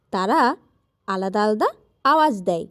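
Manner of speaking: ordinary talk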